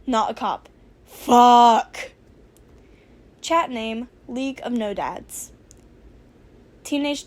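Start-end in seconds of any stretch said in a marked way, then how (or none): 1.1-2.1 loud curse. Acted. Character swears in panic.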